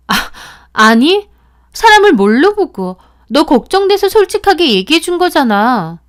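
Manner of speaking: loud, high indignant protest; angry outburst. Acted. Friend getting angry at the accusation, loud indignant protest. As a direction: bristle with offended anger. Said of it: "화까지 내더라고요".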